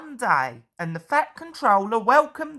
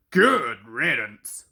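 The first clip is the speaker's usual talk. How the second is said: low, slow grumble; grumbling; 9 semitones lower, 3 dB louder, slower than usual. A narrator's reading. Gordon grumbles at the visitor, low and slow. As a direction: grumble it sourly. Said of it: "grumbled Gordon".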